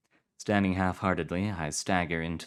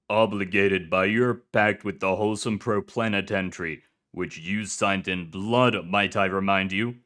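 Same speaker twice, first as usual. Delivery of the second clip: shouting official; 5 dB louder than usual. Acted. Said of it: "the voice begins shouting again".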